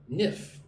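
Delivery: Nif said alone as the stressed syllable; teacher isolates the syllable Nif. Shown as a demonstration. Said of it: "Put the emphasis on the second syllable".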